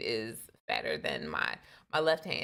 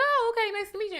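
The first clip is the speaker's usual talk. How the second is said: much higher, louder, sugary politeness; overly sweet; 5 dB louder, much faster than usual. Retold or imitated. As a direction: chirp it like a forced pleasantry. Voicing her polite reply.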